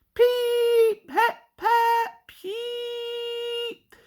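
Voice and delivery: high, loud whistle peeps; angry whistle peeps. A narrator's reading. Sir Handel squeezing past George, angry high whistle toots. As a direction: toot an annoyed train whistle, high. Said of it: "Sir Handel whistled angrily".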